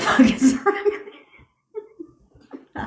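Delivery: high, drawn out through laughter; playful rebuke dissolving into laughs; laughing mock scolding. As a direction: crack up while pretending to scold. Imagined scolding breaks into laughter.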